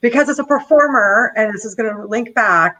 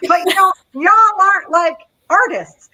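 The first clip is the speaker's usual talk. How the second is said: a laughing, higher mock Southern "y'all"; laughing Southern accent attempt; 7 semitones higher, 4 dB louder than usual. Shown as a demonstration. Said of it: "oh God, I can't do accents".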